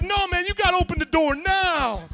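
loud, high-pitched urgent order; urgent demand. Retold or imitated. Pastor begging a man to open the bathroom door, urgent shout. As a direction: raise your voice, desperate to get in.

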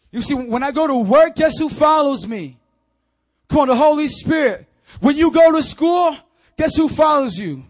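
loud, high pulpit call; rousing, shouted sermon delivery; full-force preaching. Real, not acted. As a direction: fire up the congregation with pointed questions.

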